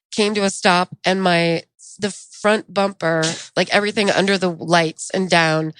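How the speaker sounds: normal talking voice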